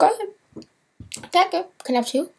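high, squeaky voice for himself asking the bread guy; nervously polite and high-pitched; squeaky nervous self. Retold or imitated. Nervously asking the bread guy for two, squeaky high. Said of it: "I'm like".

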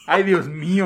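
mock flustered gasp.

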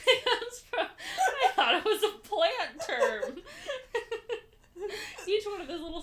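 laughing hard while talking; words through big laughter. Real, not acted. Laughing after misreading.

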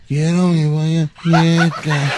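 slow, dragged-out imitation of a spiritless caller; mock listless caller. Host mocks caller's weak answer, slow and listless. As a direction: drag the words out, parodying no enthusiasm. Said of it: "Con ese mismo ánimo que usted está hablando".

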